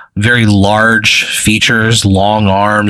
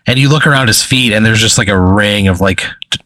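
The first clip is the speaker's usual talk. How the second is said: usual speaking voice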